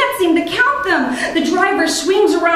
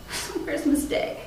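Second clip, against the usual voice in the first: breathy whisper, fading out; whispered lament; 12 dB quieter than usual. Acted. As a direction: whisper it as if to yourself. Repeated lament, whispered echo.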